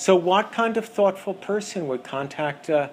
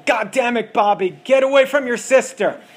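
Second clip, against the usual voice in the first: much higher, louder, scolding yell; mother yelling; 7 semitones higher, 7 dB louder than usual. Acted. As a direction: snap at the kids mid-call. Mother yelling at child on call.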